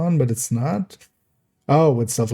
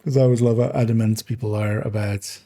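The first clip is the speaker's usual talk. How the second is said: normal talking voice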